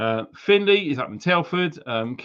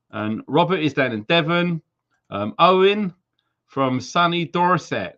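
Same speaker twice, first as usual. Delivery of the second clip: normal talking voice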